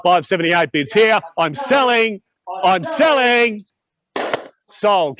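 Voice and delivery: auctioneer final call. Acted. Final calls before the hammer.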